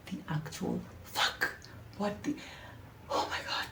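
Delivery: breathless whispered panic; panicked whisper. Retold or imitated. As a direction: whisper it, frozen in fear. Panicked inner thought, frozen.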